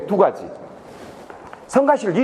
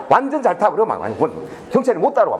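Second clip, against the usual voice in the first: usual speaking voice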